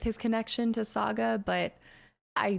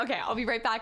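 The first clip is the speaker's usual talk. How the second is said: ordinary talk, nothing marked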